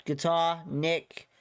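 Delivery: ordinary talk, nothing marked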